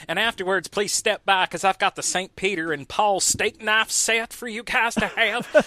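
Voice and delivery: high, loud preacher pitch; televangelist. Acted. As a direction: sell it like a TV preacher. Continues televangelist bit.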